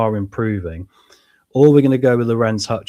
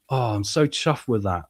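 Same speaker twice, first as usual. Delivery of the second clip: ordinary talk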